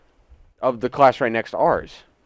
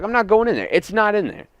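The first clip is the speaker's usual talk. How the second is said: angry refusal; 8 semitones higher than usual. Retold or imitated. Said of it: "I was like".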